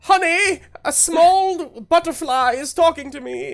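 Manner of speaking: high, loud panicky gentleman; flustered man. Acted. Fleeing man tells his wife a butterfly spoke, flustered. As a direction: babble while scurrying away.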